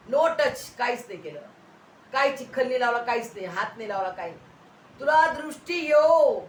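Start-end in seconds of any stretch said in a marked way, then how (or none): 5.0-6.4 high, very loud proclamation; imitated healing command. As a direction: declare the healing with full authority. (मोठ्या, उंच स्वरात आज्ञा)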